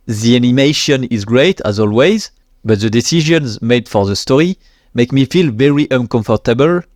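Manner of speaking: English read out in a thick French accent. Real, not acted. Quoting a classmate's English comment to viewers, French accent. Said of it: "mon accent français, euh, horrible".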